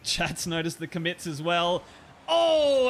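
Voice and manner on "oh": long, high, loud "oh"; drawn-out commentator's cry; excited goal call. Real, not acted. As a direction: erupt as the goal goes in. Goal scored in live commentary.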